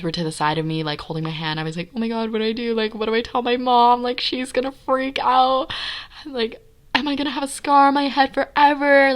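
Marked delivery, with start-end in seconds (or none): 1.9-9.1 retold panic. Bleeding, worrying about mom.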